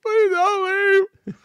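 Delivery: very high, drawn-out fake crying plea; hammed-up wail, begging them to stay; mock tearful begging. Mock begging of departing teammates.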